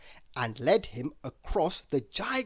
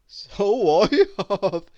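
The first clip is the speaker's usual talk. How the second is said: giggling; 6 semitones higher, 9 dB louder, slower than usual. A narrator's reading. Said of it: "he giggled".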